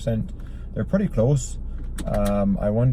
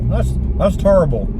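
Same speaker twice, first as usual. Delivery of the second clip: higher-pitched catchphrase impression; Charles Barkley; 5 semitones higher, 4 dB louder than usual. Host trying out his one Barkley word, impression. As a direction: do a punchy sportscaster catchphrase. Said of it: "pretty much the only word I can say is terrible".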